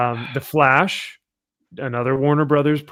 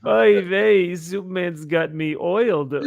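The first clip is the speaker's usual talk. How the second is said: put-on Yiddish-accented oy vey, louder; 4 semitones higher, 4 dB louder, slower than usual.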